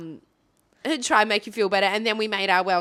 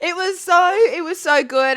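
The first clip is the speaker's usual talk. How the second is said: ordinary delivery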